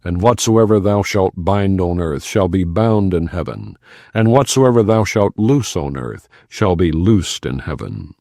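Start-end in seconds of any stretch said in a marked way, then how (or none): none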